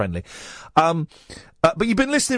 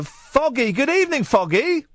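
sing-song greeting; 7 semitones higher than usual. Acted. Host's sing-song caller greeting.

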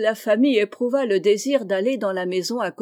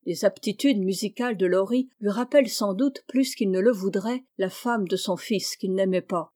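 ordinary delivery

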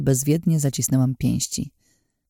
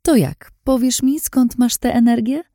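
higher, smug, teasing voice; sly Lin, taunting; 10 semitones higher than usual. A narrator's reading. Lin teasing Anna she has seen through her disguise, sly and smug. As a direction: purr it out like a scheming woman. Lin's voice in narration.

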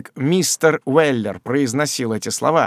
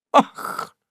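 breathless offended gasp; gasp of wounded innocence. A narrator's reading. Job gasps in mock virtue at Sam's threat. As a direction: gasp as if wrongly accused. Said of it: "с видом изумлённой добродетели".

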